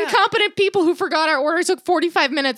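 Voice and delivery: high, loud indignant complaining; exasperated rant. Retold or imitated. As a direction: pitch it up and blow off steam. Venting about bad ice cream shop.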